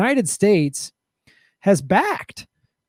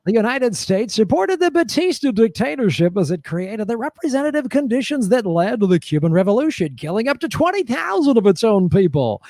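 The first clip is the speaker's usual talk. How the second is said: higher-pitched announcer read; showy host voice, pitched up; game show host; 4 semitones higher than usual. Acted. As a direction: read grim history like prize copy. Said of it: "read about death squads in the game show voice".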